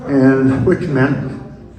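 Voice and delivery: ordinary delivery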